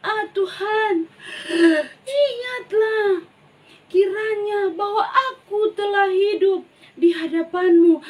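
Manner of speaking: the king pleading with God, sobbing; weeping prayer. Acted. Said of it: "Kon dia menangis dengan sangat".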